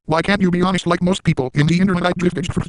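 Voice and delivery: high-pitched mock meltdown at the trolls; spaz rant. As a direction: rattle it off in a hyper, unhinged fit. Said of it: "I will spaz at some of these comments".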